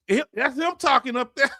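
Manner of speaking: talking through real laughter; laughing. Real, not acted. Host laughs while pointing out the commenter to the chat, giggling. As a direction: laugh as you point him out.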